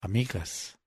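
eco hueco, mucho más suave; eco lejano. A narrator's reading. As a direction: como un eco dentro de una caracola. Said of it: "el eco sonaba en el interior". (eco suave y lejano)

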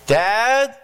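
high, rising call; son yelling out. Acted. Son calling his father after lights out, yelling. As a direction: call out for Dad from bed. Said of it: "the son yells out".

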